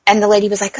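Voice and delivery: normal talking voice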